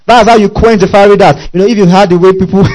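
loud, high preaching climax; full-force sermon cry. Real, not acted. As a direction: preach it out with fire.

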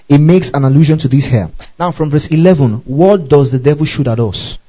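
ordinary talk, nothing marked

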